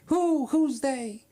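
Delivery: high, mock-incredulous wife; alarmed wife. Wife questioning paranoid husband, high incredulous voice. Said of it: "She said".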